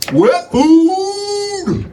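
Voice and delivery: high, slow whiny cat voice, stretched out; hungry cat whine. Pretend. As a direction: beg for food like a hungry cat. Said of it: "But when I'm hungry".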